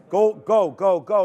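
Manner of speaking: loud, high urging cheer; excited cheering. Real, not acted. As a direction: chant it like a fan willing it on. Cheering the stock through resistance.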